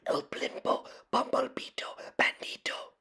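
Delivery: hushed, breathy whisper of the crowd. A narrator's reading. The frightened crowd whispers the bandit's name. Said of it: "whispered the crowd".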